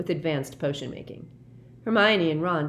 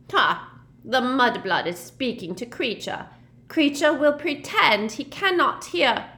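put-on high, louder sneering old elf voice; croaking elf; 9 semitones higher, 6 dB louder than usual. A narrator's reading. Kreacher sneers at Hermione in a croaking elf voice. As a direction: spit it out like a spiteful old house-elf. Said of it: "croaked at the ceiling".

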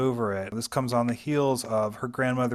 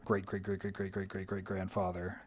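trailing softly; 4 semitones lower, 10 dB quieter than usual. Real, not acted.

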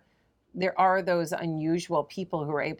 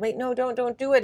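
higher-pitched mock alarm; urgent plea; 7 semitones higher, 4 dB louder than usual. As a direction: act out pleading with people to stop. Acted plea to stop cliff jumpers.

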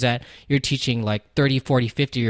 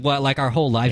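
ordinary delivery

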